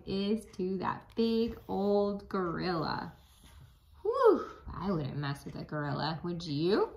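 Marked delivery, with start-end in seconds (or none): none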